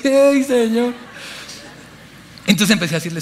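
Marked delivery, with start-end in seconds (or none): 0.1-0.9 high, hammed-up tearful plea; mock crying. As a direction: wail to God in pretend tears.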